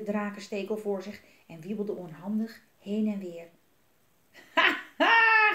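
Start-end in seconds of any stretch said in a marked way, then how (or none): 4.5-5.5 high, loud acted "ha ha"; shrieking with laughter. A narrator's reading. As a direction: burst out in a yelled laugh. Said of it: "gilde Jelmer van het lachen".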